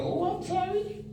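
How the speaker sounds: very high, falsetto fake apology rising at the end; hammed-up falsetto sorry from a ticketed offender; mock apology. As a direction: squeak out a fake insincere sorry.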